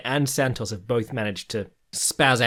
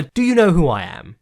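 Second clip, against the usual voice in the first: mock diva; 5 semitones higher, 7 dB louder than usual. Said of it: "I wanted to do, like a".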